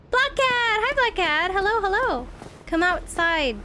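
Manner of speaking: high sing-song pet call; calling the cat. Real, not acted.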